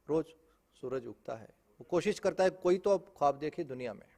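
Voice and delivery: ordinary delivery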